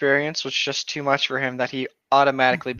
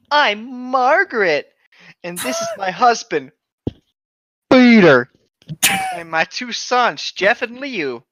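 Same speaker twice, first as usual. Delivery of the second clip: hammed-up mom; 8 semitones higher, 4 dB louder, slower than usual. A narrator's reading. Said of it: "said Jeff's mom".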